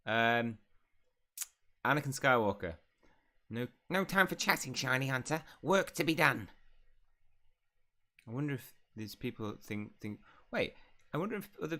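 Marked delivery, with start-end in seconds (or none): 3.5-6.5 high, loud bossy elf voice; high-pitched, loud taskmaster; scolding elf. Acted. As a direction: order them back to work, high and loud. Elf barks work orders in game.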